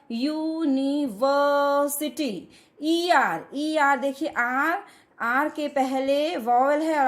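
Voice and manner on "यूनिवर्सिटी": "यूनिवर्सिटी" drawn out slowly in syllables, starting on a y sound. Shown as a demonstration. Teacher reading the phonetic transcription, "यूनिवर्सिटी" slowly by syllables. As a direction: stretch each syllable of "यूनिवर्सिटी". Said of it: "ये य".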